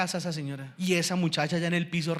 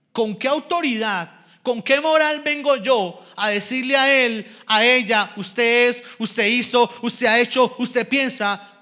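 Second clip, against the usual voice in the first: loud, insistent rhetorical challenge; forceful preacher, pitch lifted; indignant preaching; 4 semitones higher, 7 dB louder, slower than usual. Real, not acted. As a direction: pound out each accusation from the pulpit.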